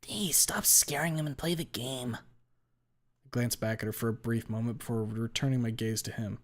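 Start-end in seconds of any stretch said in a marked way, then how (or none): 0.0-2.1 higher voice, scolding a friend; higher-voiced girl telling off her friend; Olivia cutting in. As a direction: call her off, higher and exasperated. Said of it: "Olivia's voice cuts through the hushed whispers".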